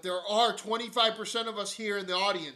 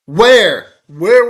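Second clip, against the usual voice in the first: angry shout; 21 dB louder than usual. Real, not acted. Host shouting at the war secretary, furious. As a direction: shout the demand, much louder.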